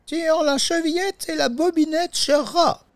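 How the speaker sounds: put-on very high falsetto old-granny voice; sustained high-pitched granny sing-out; grandmother calling. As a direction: call out like a storybook granny from bed. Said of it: "lui répond la grand-mère depuis son lit".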